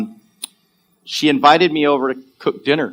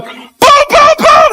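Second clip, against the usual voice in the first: high, very loud banging noises; explosive, rapid mock bangs; loud booming imitation; 9 dB louder, much slower than usual. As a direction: imitate pounding on a door. Imitates dad banging the door.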